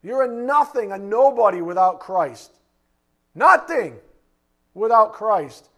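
loud, hammered declaration; fiery preacher at full force; preaching shout. Acted. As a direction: pound each word home. Preacher hammers the point loudly.

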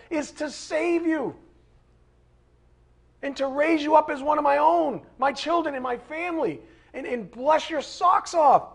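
voicing God. Preacher speaks as God.

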